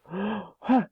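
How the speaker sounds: quick startled gasp; sharp gasp. Acted. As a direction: gasp in surprise. Startled gasp on transforming back.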